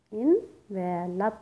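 envelop very slowly in three parts, en, ve, lop; syllable by syllable. Shown as a demonstration. Teacher counts syllables for students, envelop split slowly in three. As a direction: break envelop into en, ve, lop with gaps, en rising. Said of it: "number of syllables हैं three".

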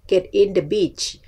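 ordinary talk

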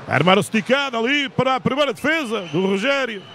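live sports commentator calling action; play-by-play commentary. Real, not acted. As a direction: call the play as it happens.

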